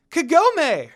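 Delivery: high, loud joyful cry of a name; excited exclaim. A narrator's reading. Friends reuniting with Kagome, loud joyful call. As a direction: call out her name, overjoyed to find her. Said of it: "they exclaimed".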